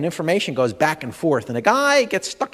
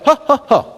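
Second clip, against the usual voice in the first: three loud high breath-out huffs; three exhale huffs; 8 semitones higher, 7 dB louder than usual. Shown as a demonstration. Leading the crowd in an exercise, three loud huffs. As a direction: puff out the air three times hard. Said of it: "Three times, go".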